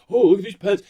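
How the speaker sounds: high, loud mock-gushing shopper; sarcastic imitation of excited shoppers. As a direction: gush over the pens like a dopey patriot. Mocking patriotic shoppers at store.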